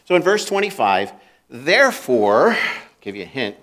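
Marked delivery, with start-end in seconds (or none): 1.5-2.6 "Therefore" said slow and louder; weighty "Therefore", drawn out for emphasis; stressed Therefore. Shown as a demonstration. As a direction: lean hard on "Therefore" to flag it. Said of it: "it's a big word".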